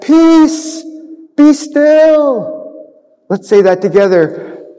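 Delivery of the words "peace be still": high, firm command; Jesus commanding the wind and waves. Acted. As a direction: order the storm to calm. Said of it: "speaks to the storm with a loud voice".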